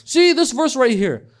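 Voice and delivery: high, loud, slow mocking voice of a critic; a hammed-up detractor jabbing at the verse. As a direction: pitch it up and lay it on thick.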